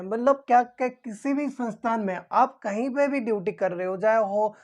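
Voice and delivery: ordinary talk, nothing marked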